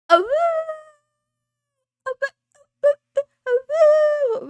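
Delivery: high, loud wolf howls. Acted. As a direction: howl like a wolf to the moon. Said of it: "say to all the wolves and puppies".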